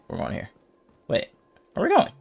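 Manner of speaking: usual speaking voice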